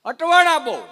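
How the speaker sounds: catchphrase belted out high and loud; punchline shout. Real, not acted. Comedian belts the catchphrase at the crowd, loud and high. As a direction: hammer home the punchline to the crowd.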